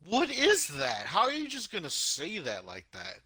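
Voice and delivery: high-pitched, baffled protest; incredulous outburst. Acted. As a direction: balk at it in mock disbelief. Acted shock at the crude line.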